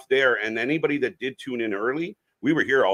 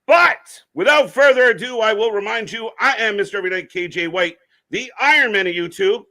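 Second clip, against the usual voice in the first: raised announcer introduction; announcer intro; 6 semitones higher, 5 dB louder than usual. Real, not acted. Introducing cohosts.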